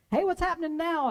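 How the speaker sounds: a prisoner calling out for news; yelled question. Retold or imitated. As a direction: shout it down the tier. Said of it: "he yells".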